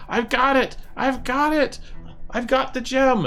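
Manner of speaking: high-pitched goblin crowing; squeaky goblin minion calling across; excited goblin. Acted. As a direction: announce it in a high, triumphant goblin voice. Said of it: "comes across and is like".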